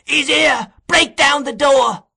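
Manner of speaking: very high, loud drawn-out yell; slow, full-force high shouting; boy's shriek. A narrator's reading. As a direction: shriek to the crowd outside. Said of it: "shrieked the boy".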